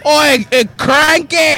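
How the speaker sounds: hammed-up Australian exclamation; badly faked Aussie, drifting Scottish; Australian accent. Shown as a demonstration. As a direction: call out in a mock Australian accent. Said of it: "I can pull an Australian accent".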